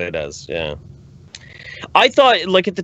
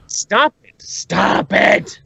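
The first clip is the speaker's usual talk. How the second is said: high mock pleading; 6 semitones higher, much slower than usual.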